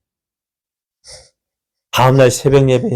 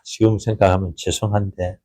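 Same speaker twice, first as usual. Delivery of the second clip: rueful whisper; 6 semitones lower, 6 dB quieter than usual. Real, not acted. Sheepish confession aside.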